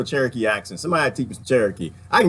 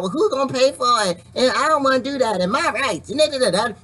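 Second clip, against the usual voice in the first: mock whiny debater; 9 semitones higher than usual. Mocking politicians' complaints.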